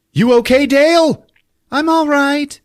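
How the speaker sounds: squeaky hero voices. Acted. Podcast host voicing the heroes after their umbrella drop, in a high squeak. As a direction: play the rescued heroes in a silly high squeak.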